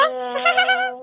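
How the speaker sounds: put-on high, bright little girl's giggle; child's giggle. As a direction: giggle cheekily like a small girl. Child laughs at grandma.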